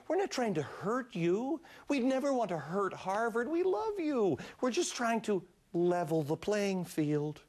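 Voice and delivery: hammed-up placating plea; mock reassurance. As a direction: coax them with exaggerated affection. Mock soothing plea to Harvard.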